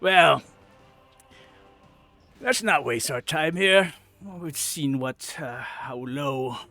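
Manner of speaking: scornful goblin farewell; dismissive hobgoblin. Acted. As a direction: brush them off and leave.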